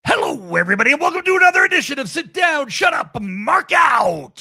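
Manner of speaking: loud, high, fast announcer's open; show intro shout. Acted. Host opening the show to listeners, loud hyped announcer voice. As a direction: hype up the podcast open like a ring announcer.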